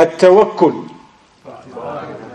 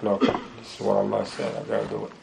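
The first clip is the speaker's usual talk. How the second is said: low, soft self-talk; quietly acting out someone resolving to obey; obedient believer's self-talk; 5 semitones lower, 10 dB quieter than usual. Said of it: "he's like".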